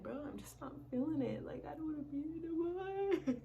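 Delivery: high, whiny "I'm just not feeling it"; mock whiny complaint. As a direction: whine it like you're done with everything. Quoting her own whiny reply.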